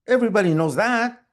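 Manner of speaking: loud, high and quick; exasperated retort. Acted. Son answering mom's big secret, loud exasperated retort. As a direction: fire back as if it's obvious. Son's punchline to mother's confession.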